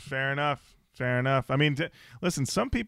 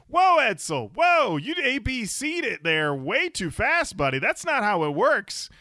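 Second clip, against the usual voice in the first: mock alarm; 7 semitones higher, 4 dB louder than usual. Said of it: "I'm like".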